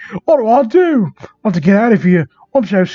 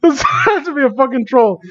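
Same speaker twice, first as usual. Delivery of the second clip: much higher, crying with laughter; real laughing disbelief; laughing shriek; 8 semitones higher than usual. Real, not acted. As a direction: squeal in disbelief. Disbelief at fanfic.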